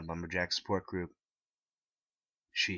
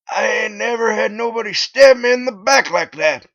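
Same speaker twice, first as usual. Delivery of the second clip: much higher, louder hurt yell; loud high betrayed shout; 18 dB louder than usual. A narrator's reading. Bob the lumberjack shouting his hurt at the narrator, high and loud. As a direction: shout it like he's been stabbed in the back. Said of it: "he said to me".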